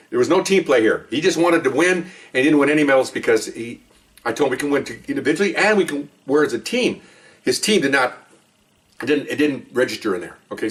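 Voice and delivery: normal talking voice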